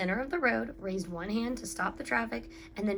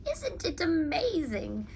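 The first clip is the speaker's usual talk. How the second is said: put-on old lady marveling; 6 semitones higher, slower than usual. A narrator's reading. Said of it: "An old lady from Beacon Hill said".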